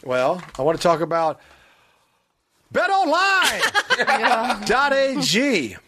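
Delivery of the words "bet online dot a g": stretched-out sponsor name; slow announcer read. Acted. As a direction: drag out the brand like an ad.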